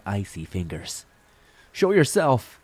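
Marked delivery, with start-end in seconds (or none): 1.7-2.6 high, loud yell into the void; loud, high-pitched demand. A narrator's reading. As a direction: shout a challenge out into the fog. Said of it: "I yelled into the void".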